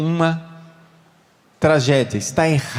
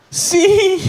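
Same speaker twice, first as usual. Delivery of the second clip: a high, laughing "Sim" from the woman; much higher mock woman's yes; 13 semitones higher, 3 dB louder than usual. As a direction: squeak out a high yes as the wife. Woman answers the priest.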